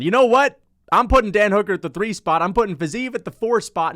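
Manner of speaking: loud, high burst of excitement; excited outburst. Real, not acted. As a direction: blurt out the new ranking, fired up. Sudden excited ranking decision.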